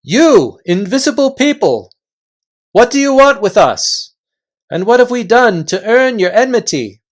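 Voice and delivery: calling out loud and high to unseen people. A narrator's reading. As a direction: project to voices you cannot see. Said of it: "added in a louder voice".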